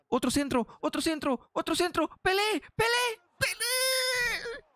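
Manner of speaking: urgent play-by-play rising to a very high yell; building excitement. Real, not acted. Gamer urging Pelé on at goal, building excited cries. Urging a chance in live match.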